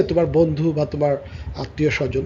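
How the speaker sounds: ordinary talk, nothing marked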